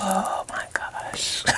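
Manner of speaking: whispered sigh, catching breath; breathless whisper. Real, not acted. Tired reader catching her breath, whispered. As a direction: exhale it out, barely voiced. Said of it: "take a little breather".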